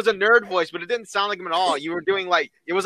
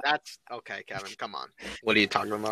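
very low mock gruff voice; deep voice; 11 semitones lower, 9 dB quieter than usual. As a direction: drop it far down and scoff.

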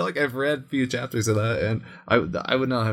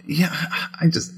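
ordinary delivery

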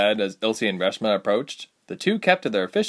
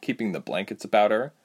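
plain reading voice

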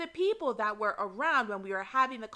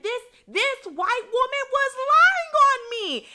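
much higher and louder, indignant; furious rising indignation; outraged; 9 dB louder than usual. Real, not acted. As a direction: cry out in disbelief at the lie. Outraged at coworker's lie.

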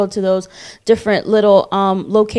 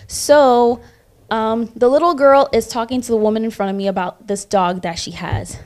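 ordinary talk, nothing marked

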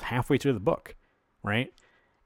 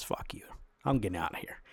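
much softer, breathy whisper; whispered brush-off; 3 semitones lower, 9 dB quieter than usual. Retold or imitated. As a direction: hiss it under your breath. Bruce brushing off his hosts.

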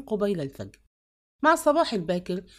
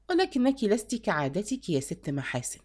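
usual speaking voice

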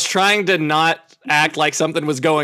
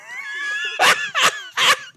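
high screaming cackle; shrieking laugh. Real, not acted. Big laugh after own joke.

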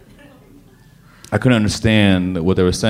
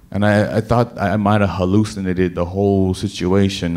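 normal talking voice

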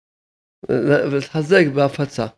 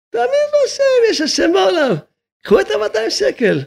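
high mocking voice. Rabbi scoffing at those arguing over two hundred shekels, high and mocking. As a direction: jump way up into a high, incredulous mocking pitch.

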